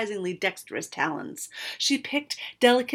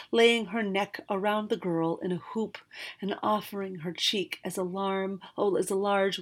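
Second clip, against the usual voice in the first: usual speaking voice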